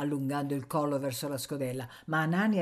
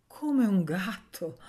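slow, teeth-clenched disgusted echo; 5 semitones higher, 3 dB louder, much slower than usual. Acted. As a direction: wrinkle the nose. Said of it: "stringendo i denti e torcendo il nasino". (con disgusto, a denti stretti)

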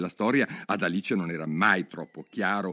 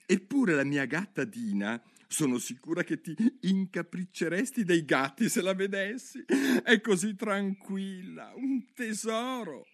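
put-on higher girl's voice, fond; Alice chatting; 10 semitones higher than usual. Alice praises her cat Dina to the mouse, fond and higher.

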